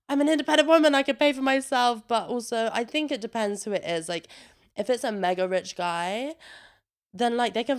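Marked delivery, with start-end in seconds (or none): 0.1-2.1 higher, louder, hammed-up independent woman; playful boast, higher and louder; mock proud declaration. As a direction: announce it like a proud anthem.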